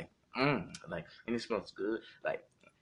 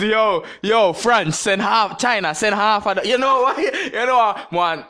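excited; 8 semitones higher, 8 dB louder than usual. Pretend. Joking about adopting babies worldwide.

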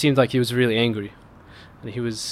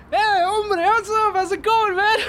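much higher, laughing buddy greeting; friendly stranger. Retold or imitated. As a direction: greet him like an old pal. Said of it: "And he goes".

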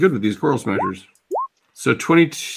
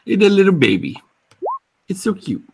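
cooing; 8 semitones higher, faster than usual. Acted. GM coos over juvenile Kraken.

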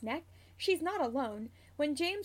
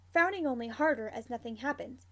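ordinary delivery, nothing marked

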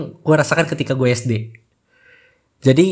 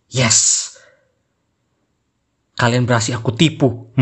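sneering panto villain; 3 semitones lower than usual. Retold or imitated. Gloating inner thought, evil laugh.

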